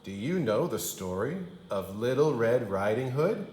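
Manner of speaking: ordinary delivery, nothing marked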